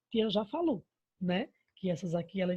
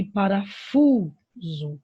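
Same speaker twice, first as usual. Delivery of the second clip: "parafuso" slow, with "fu" long and loud; stress on FU; 6 dB louder, much slower than usual. Shown as a demonstration. Teacher showing the strongest syllable, "fu" stretched in "parafuso". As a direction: hold the "fu" in "parafuso", then drop "so". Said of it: "a sílaba mais forte é o fu".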